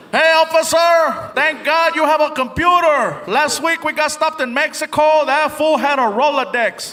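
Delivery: high, drawn-out holler from afar; yelling. Acted. Friend heckling the cop from the back seat, yelling. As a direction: yell it across to the officer. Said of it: "he's being yelled at".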